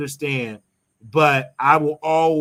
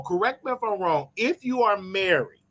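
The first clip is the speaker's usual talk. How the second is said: normal talking voice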